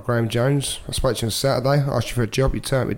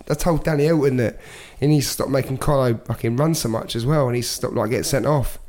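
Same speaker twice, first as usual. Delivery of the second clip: usual speaking voice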